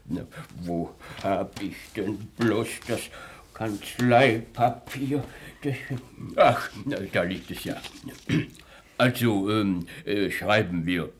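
normal talking voice

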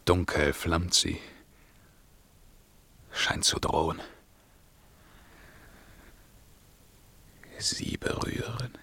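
hushed, broken whisper over the dead; king whispering over his dead beloved; grieving whisper. Acted. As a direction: whisper it to yourself.

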